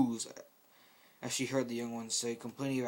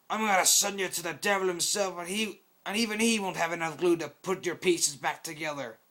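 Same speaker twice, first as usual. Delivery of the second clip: loud, higher menacing shout; angry threat; 6 semitones higher, 6 dB louder than usual. Acted. Brawler threatening his teammate mid-fight, shouting angrily. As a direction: threaten to tear him apart.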